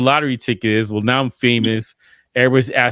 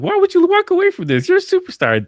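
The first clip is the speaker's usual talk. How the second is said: put-on much higher, starry-eyed kid; naive kid voice. As a kid asking why stars quit, high and awed. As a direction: squeak it like an awed child.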